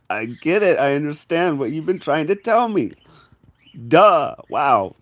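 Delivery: loud, high burst of sudden understanding; excited realization. Acted. Telling someone you finally understand, loud and excited. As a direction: blurt it out as it finally clicks. Acted breakthrough moment.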